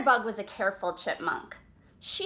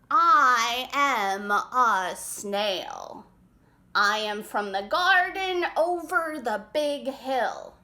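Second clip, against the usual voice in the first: very slow, louder, each word drawn out; slow snail voice; 5 dB louder, slower than usual. Acted. Snail slowly telling the animals where she comes from. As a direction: speak at a snail's pace. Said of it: "After a long pause".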